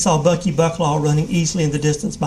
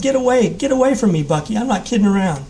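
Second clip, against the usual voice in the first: yelling; 6 semitones higher than usual. A narrator's reading. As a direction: scream it to drive him back. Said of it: "Jim yelled".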